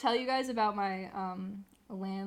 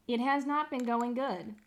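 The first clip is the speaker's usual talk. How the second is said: ordinary talk, nothing marked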